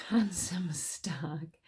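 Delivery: slow dark whisper; whispered reveal. A narrator's reading. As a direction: hush the grim twist. Grim punchline.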